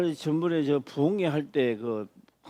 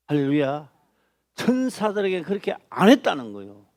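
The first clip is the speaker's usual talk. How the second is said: usual speaking voice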